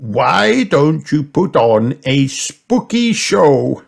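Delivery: Grandpa Pig. A narrator's reading. Grandpa suggests a show, slow lifted voice.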